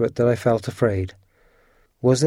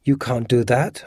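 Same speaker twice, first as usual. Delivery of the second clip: ordinary delivery, nothing marked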